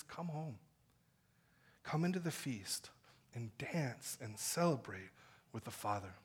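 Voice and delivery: soft, low gentle call; tender invitation. Acted. Jesus gently calling sinners home, soft and low. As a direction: coax them home quietly.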